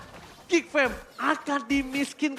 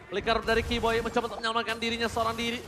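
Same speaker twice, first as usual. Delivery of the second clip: usual speaking voice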